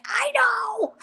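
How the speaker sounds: high, pinched reply through the pain; mock wincing agreement; strained pained whimper. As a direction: squeak it out as the needle bites. In pain under the needle.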